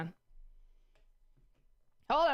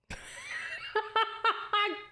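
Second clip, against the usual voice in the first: high-pitched giggle; giggling fit; 13 semitones higher than usual. Real, not acted. Laughing at own words.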